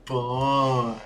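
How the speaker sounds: slow, sing-song dog praise; talking to a dog. Real, not acted. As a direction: gush over the dog slowly. Baby talk praising the dog.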